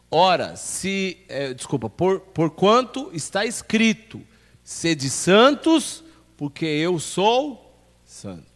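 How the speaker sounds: loud, slow, emphatic Bible reading; scripture reading. Acted. Pastor reading First Peter to the church, proclaiming. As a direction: proclaim the verse with weight and spaced phrases. Reads scripture aloud to congregation.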